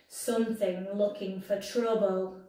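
ordinary delivery, nothing marked

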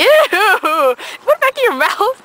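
grossed-out, slow and high; disgusted shriek. Real, not acted. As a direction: recoil in disgust, drawn out. Disgusted at armpit then mouth.

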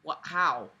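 ordinary talk

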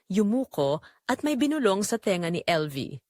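ordinary delivery

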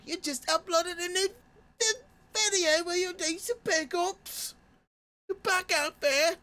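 very high silly falsetto; getting weird. Pretend. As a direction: gush in a squeaky put-on voice. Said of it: "so he's getting weird now".

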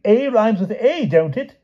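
high, loud protesting king; crying out. A narrator's reading. King argues his rhyme scheme with Palomides, crying out high. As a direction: insist on it in a high cry. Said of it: "cried the king".

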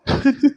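a short, high, fond laugh; amused laugh. Acted. Fiancé laughing fondly at her childish answer.

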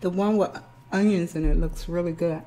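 ordinary talk, nothing marked

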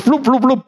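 high mock slipping sound; slippery flup sounds. Describing slippery newborn, playful high squelch noises. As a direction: mimic things squelching out of your hands. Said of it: "alles, was du anrührst".

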